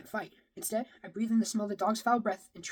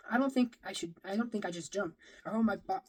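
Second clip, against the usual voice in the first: plain reading voice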